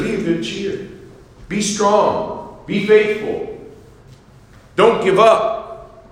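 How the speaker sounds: high, bright rallying call, slowing on the end; preacher calling out encouragement; upbeat encouragement. Shown as a demonstration. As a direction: lift them up with strong cheer. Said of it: "Instead of a weak, downhearted response".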